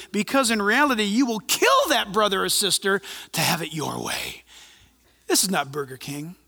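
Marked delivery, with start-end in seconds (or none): none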